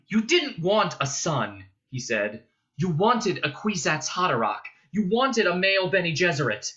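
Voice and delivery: high, loud bitter accusation. A narrator's reading. Paul accusing his mother, loud bitter reproach. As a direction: throw the blame at her, bitterly. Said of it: "She recoiled from his bitterness".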